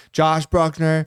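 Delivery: lightly imitated speaker announcement; mock hospital page. Husband imagines being paged at hospital, mock announcement. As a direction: pretend to page someone by name. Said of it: "page me, like, over the hospital speaker".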